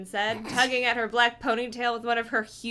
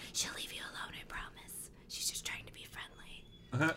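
soft unvoiced whisper; hushed reassuring whisper; 10 semitones lower, 16 dB quieter than usual. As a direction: whisper it so she won't hear. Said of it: "also whispering".

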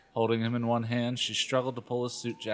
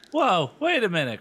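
high, loud indignant protest; angry, shrill; 9 semitones higher, 6 dB louder than usual. A narrator's reading. Chris objects to her sister's accusation, shrill and angry. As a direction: cut in shrilly, pitched right up. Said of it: "replied angrily in a shrill".

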